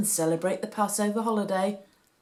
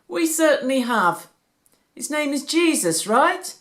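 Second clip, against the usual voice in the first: grinning priest; 8 semitones higher, 8 dB louder than usual. A narrator's reading. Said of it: "the priest grinned".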